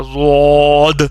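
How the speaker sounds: صاد said thick and heavy, slowly drawn out; heavy zaad. Shown as a demonstration. Teacher showing students the heavy zaad, slow. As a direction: give the dotted صاد a full heavy sound. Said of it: "تب جا کے یہ لفظ ادا ہوگا".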